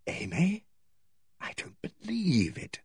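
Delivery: high, whispered disbelief; dazed, dreamlike. A narrator's reading. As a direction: whisper slowly like a man in a dream. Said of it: "he said like a man in a dream".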